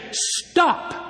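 high, commanding Stop, the word Stop leaping up; sharp high command. Retold or imitated. Jesus commands demons to stop.

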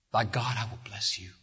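hushed whispered oath; whispered solemn vow. Acted. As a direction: drop to a breathless whisper for the promise. God's oath repeated hushed.

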